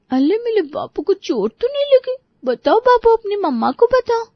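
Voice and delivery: very high-pitched lisping mother voice; cooing baby talk. Acted. Mother asks toddler if he got hurt, cooing. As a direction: fuss over a little one in baby talk.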